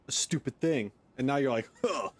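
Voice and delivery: usual speaking voice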